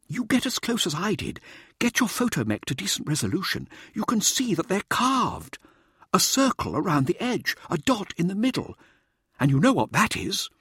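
high, insistent showboater's voice; showboating Mirza. A narrator's reading. Showboater prompts his anxious audience, high and insistent. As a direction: lecture the team in a high, theatrical voice.

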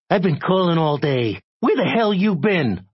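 high, agitated male caller; frantic high-pitched phone demand; breathless rush. A narrator's reading. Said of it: "It all came out in one long, breathless rush".

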